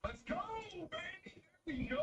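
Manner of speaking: high, excited whoop after the win; shouted joy at the final kill; victory cheer. Real, not acted. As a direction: celebrate the round like you just won it. Celebrating the win.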